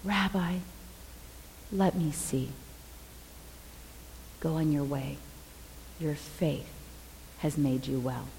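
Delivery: low, hushed, unhurried phrases; soft, tender. Retold or imitated. Blind man and Jesus in the healing moment, softly. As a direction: speak softly and low, pausing between phrases.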